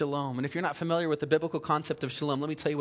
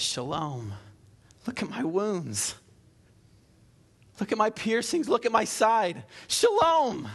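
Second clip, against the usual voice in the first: building to a cry; 5 semitones higher, slower than usual. Acted. Jesus showing wounds to his disciples, building exclaim. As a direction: build each line to a high exclaimed Shalom.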